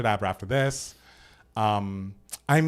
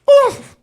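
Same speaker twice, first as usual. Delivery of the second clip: squeaky high oof; high yelp; 11 dB louder than usual. Real, not acted. Reaction sound.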